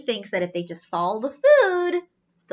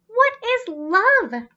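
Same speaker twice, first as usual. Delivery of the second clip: put-on curious young robot; 10 semitones higher, 3 dB louder, slower than usual.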